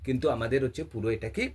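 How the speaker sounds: normal talking voice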